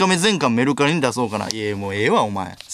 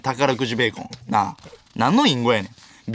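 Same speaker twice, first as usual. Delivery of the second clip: ordinary talk, nothing marked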